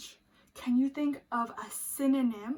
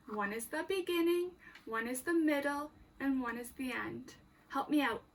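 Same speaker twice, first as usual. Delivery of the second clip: usual speaking voice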